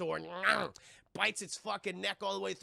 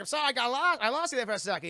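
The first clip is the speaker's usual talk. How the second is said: higher, louder acted reaction; mock bewilderment; 5 semitones higher, 7 dB louder, faster than usual. [name] answers the captain he lost track of, in raised mock bewilderment. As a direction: play it up as baffled, pitched up.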